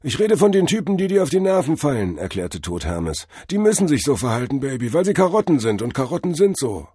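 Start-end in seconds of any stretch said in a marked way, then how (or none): 0.0-2.2 high, loud hip guru; jive-talking drug-vision god. A narrator's reading. God explains annoying people to Cordell, high loud guru. Figure of Tod Hermes. 3.5-6.9 high, quick hip guru; jive-talking drug-vision god. A narrator's reading. God explains carrot nature to Cordell, high quick guru. Figure of Tod Hermes.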